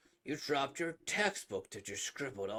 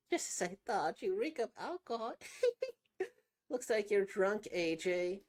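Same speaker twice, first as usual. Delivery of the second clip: teasing; 6 semitones higher, 4 dB louder than usual. Acted. Teasing friend about drinking.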